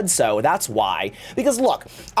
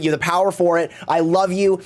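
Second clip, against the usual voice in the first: ordinary delivery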